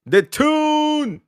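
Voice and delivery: high squeal of delight; excited cheer. Real, not acted. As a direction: cheer the club's nickname. Cheering his club.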